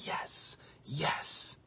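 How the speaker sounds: gloating whisper. Pretend. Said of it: "Satan is laughing, and he's like".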